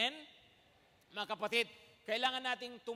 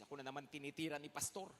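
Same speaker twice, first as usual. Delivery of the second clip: low, soft mock sulk; sulky grumble; 7 semitones lower, 5 dB quieter, faster than usual. Imitating a sulking member who feels targeted, low and soft. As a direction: grumble like a hurt churchgoer under your breath. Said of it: "Walang nagtatampo".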